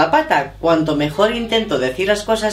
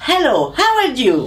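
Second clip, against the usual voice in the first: much higher, slower, with more emphasis; English emphasis; 7 semitones higher than usual. Shown as a demonstration. Mother showing how English needs more emphasis. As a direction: put on a lively English manner. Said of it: "En inglés sería".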